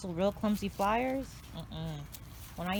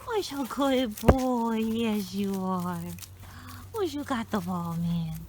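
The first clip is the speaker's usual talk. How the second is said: higher, louder, slow dog praise; squeaky praising a dog; 3 semitones higher, 4 dB louder, slower than usual. Retold or imitated. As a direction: coo at the dog, drawn out. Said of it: "He's like".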